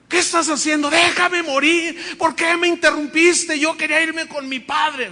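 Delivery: high, loud, reproachful outcry; desperate protest. Retold or imitated. Woman protesting to her husband who woke her, high and loud. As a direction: reproach him for interrupting.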